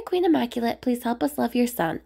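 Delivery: plain reading voice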